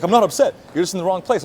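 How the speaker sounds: loud, high imitation of an upset officer; mock-irate retort; retold upset denial. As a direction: snap back defensively, as in a retold row. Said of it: "why are you so upset".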